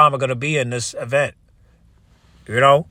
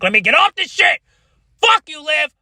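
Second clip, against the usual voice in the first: high-pitched outburst. Real, not acted. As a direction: yell it out in comic exasperation. Final high shout.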